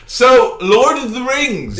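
high, loud, slow grand announcement; mock-grand topic announcement; announcing. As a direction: announce the next topic with a flourish.

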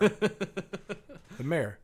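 Mayor said as one syllable, mare, laughing; a flat Midwestern mare for Mayor; Midwestern accent. Real, not acted. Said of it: "Midwestern accent coming through hard".

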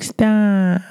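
high, whiny cartoon-kid stop; Cartman voice. Bullied kid begging bullies to stop, whiny Cartman-like voice. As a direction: whine it like Cartman. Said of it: "I sound like Cartman from South Park".

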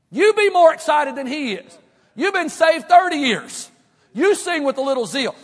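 loud, high preaching, phrase by phrase; zealous exhortation. Retold or imitated. Pastor to long-saved choir members, loudly zealous. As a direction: fire up the old-timers at full force. Exhorting veteran singers with zeal.

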